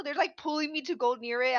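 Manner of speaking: normal talking voice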